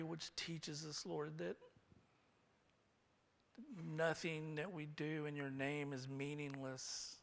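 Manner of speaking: soft, unhurried praying; hushed prayer. Real, not acted. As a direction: pray quietly with long pauses. Leads closing prayer.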